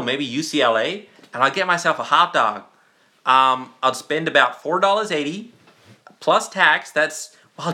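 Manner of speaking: American accent with lilting, rising phrase ends; put-on American accent. Shown as a demonstration. Streamer showing viewers his American accent, put-on American. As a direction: play up a chatty American accent. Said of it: "Can I do an American accent".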